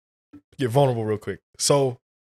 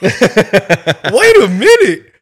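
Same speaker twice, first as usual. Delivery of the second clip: loud, high outraged yell; hammed-up indignant shouting; mock outrage; 13 dB louder, slower than usual. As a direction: yell it like you've been insulted. Acted outraged reaction to post.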